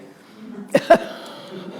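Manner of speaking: loud unvoiced laugh; airy burst of laughter; breathy laugh. Real, not acted. Laughs at his own joke.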